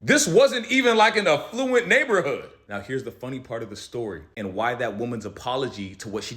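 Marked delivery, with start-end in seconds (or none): 0.0-2.5 higher and louder, incredulous; indignant outburst. Real, not acted. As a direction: blurt it out in disbelief.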